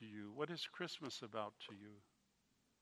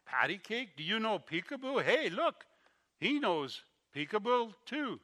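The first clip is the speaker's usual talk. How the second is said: put-on high, loud sing-song coo at a baby; delighted old man baby-talking Eric; 9 semitones higher, 9 dB louder than usual. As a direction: coo playfully like you're entertaining an infant.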